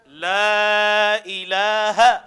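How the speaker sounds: loud, very drawn-out Arabic recitation; slow, forceful teaching chant; model recitation. Shown as a demonstration. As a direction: stretch each syllable firmly for students to copy. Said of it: "جس کو سختی سے ادا کرنا ہے".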